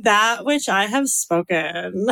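put-on slow Valley girl with vocal fry; Valley girl accent. Shown as a demonstration. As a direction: draw it out like a Southern California Valley girl. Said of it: "I kinda like this accent".

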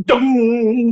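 sung-out imitation gong, held and ringing; gong sound. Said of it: "buy a gong or something".

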